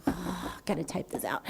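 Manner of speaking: muttering to self. Retold or imitated. Embarrassed, talking to herself.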